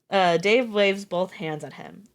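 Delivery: ordinary delivery, nothing marked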